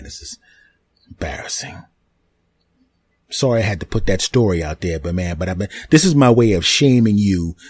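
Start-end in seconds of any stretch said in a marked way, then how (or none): 0.0-1.8 hushed, breathy whisper of shame; soft under-the-breath plea; embarrassed whisper. Retold or imitated. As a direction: whisper it like you're mortified.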